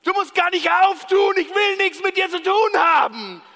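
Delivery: high, loud furious yell; you shout at God through the door in anger; angry shout. Acted. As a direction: shout him away from the door. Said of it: "bei der Türklingel von Gott und sagst".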